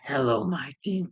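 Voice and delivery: slow, creepy villain greeting; Green Goblin. Retold or imitated. Goblin showing up to Mary Jane, slow and menacing. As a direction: draw it out like a villain.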